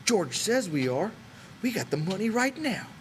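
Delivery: put-on rising, defiant old swamper; Candy defending the plan to Crooks; stung old man. A narrator's reading. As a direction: push back hard and hopeful.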